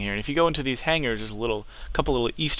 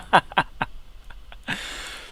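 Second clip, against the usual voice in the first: laugh; 6 semitones higher than usual. Real, not acted. Laughing after crash.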